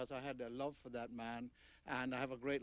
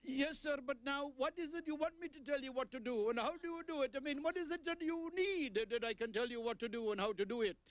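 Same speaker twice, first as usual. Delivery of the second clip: much higher put-on voice of a clerk answering the phone; Indian phone clerk; 12 semitones higher than usual. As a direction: play the trading company clerk picking up, pitched high.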